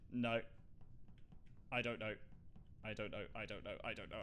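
very soft, low self-talk; anxious muttering. Real, not acted. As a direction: mumble it to yourself, barely audible. Alone, unsure, starting to panic.

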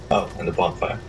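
ordinary talk, nothing marked

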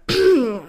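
sich traurig und zögernd räuspern; trauriges Räuspern. A narrator's reading. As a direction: ein schweres Räuspern vor der Beichte. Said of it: "Alfie räusperte sich traurig". (der Großvater räuspert sich traurig, bevor er antwortet)

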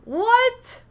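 high, loud stunned "What?"; can't believe it, blurt it out; shocked what. Real, not acted. Streamer hears a friend never played the game, stunned.

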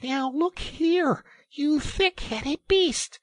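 very high, slow, loud telling-off; really angry. A narrator's reading. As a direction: lay into a stubborn friend. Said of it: "really angry".

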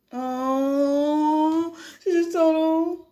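high, drawn-out "oh"; teasing reaction. Real, not acted. Reader reacts teasingly to the story.

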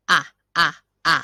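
three short "อ่ะ" bursts for the letter U; letter sound demo. Shown as a demonstration. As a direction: sound out the U for the class. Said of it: "เสียงของตัวอักษรที่ขึ้นต้น". (ออกเสียงตัว U สั้นๆ สามครั้ง)

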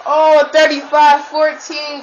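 slow, loud score call; hyped score call. Real, not acted. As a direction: announce the score with glee. Announcing score excitedly.

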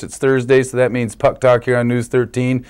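bright broadcast sign-on; news anchor intro. Acted. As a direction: greet viewers like a TV sports anchor. Host opens sports segment.

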